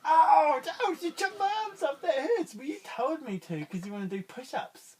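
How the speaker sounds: very high, loud yelping ouch; loud high squealing hurt starfish. Acted. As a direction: yelp high as if your arms just came off. Starfish's arms chopped off.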